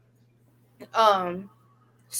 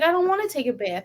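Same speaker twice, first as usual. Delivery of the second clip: put-on higher voice of a reluctant child; imitating young daughter; 7 semitones higher, faster than usual. Daughter resists a bath after her accident, lightly imitated child voice. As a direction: mimic a kid refusing, pitched up. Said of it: "she's like".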